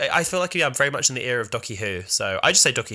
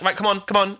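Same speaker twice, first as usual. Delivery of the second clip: higher-pitched usher chivvying someone along; hurrying usher; 7 semitones higher than usual. Retold or imitated. Imitating the person ushering fans past [name], hurrying. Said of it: "was ushering me".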